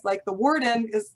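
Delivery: normal talking voice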